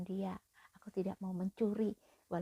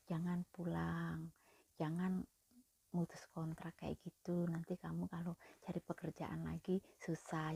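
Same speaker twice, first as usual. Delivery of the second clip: gentle advice; 3 semitones lower, 8 dB quieter than usual. Retold or imitated. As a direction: coax her gently to stay. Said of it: "dia kan ngomong juga halus". (menasihati dengan lembut)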